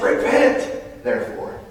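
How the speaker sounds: Repent rung out high and loud, cut short; commanding repent. Retold or imitated. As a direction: call out Repent like a command from above. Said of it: "He says".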